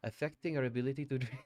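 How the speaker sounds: put-on German accent drifting off; slipping German accent. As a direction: let the German accent wander. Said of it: "I don't know what accent this is becoming now".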